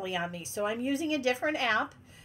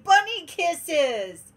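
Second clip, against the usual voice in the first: higher, louder, slow and sing-song; playful slow cooing; cutesy affection; 5 semitones higher, 6 dB louder, much slower than usual. Real, not acted. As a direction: coo it like a sweet nickname. Sweet greeting to a viewer.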